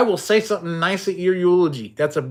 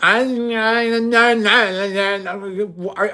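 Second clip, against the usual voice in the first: higher, stuttering mock rapper; mocking Instagram poser; 5 semitones higher, much slower than usual. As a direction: mimic the poser's stammering. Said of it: "And he's like".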